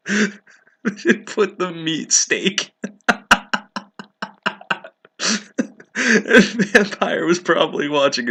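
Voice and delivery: wheezing with laughter, very slow and high; laughing. Real, not acted. Reader jokes about the stake, helpless laughter. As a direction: barely get it out through laughter.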